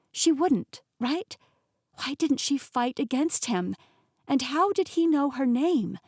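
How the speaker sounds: usual speaking voice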